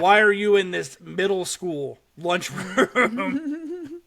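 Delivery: high, slow, laughing through the words; laughing mock question. Host mocking a grown man in a lunchroom, laughing. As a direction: laugh while asking it, half mocking. Mocking the older-looking kid.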